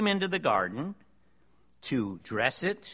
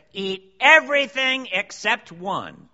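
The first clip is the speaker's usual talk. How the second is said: God's command, higher, louder and slow; booming command; 6 semitones higher, 9 dB louder, slower than usual. Retold or imitated. Preacher voicing God to the congregation, booming command. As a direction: lay it down as an order from above.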